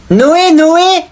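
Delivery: put-on very high loud kid shout; little cousin calling Noé about his money, high; excited child call. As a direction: call out like a thrilled little boy.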